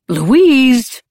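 high, loud call of her name; conciliatory protest. A narrator's reading. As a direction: cut in to stop her. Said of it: "his voice conciliatory".

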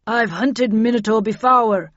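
loud, forceful commander; Bradshaw's voice. A narrator's reading. Commander briefing the team on hunting Minotaur, loud and forceful. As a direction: speak up boldly, pitch lifting. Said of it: "said Bradshaw".

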